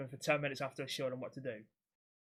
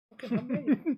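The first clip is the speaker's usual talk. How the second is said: exasperated; 11 semitones higher, 9 dB louder than usual. Retold or imitated. Exasperated instructor, retold reply.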